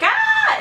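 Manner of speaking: very high, held joke yelp; playful mock scream. Joking around after her arm was grabbed, high mock scream. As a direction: squeal in pretend pain.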